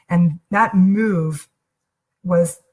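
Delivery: ordinary talk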